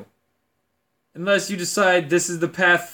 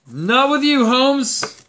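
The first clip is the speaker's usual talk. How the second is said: the line repeated higher and louder, playful; louder mock refusal; 8 semitones higher, 4 dB louder, much slower than usual. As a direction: shout it back with a grin. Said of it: "punchline repeat".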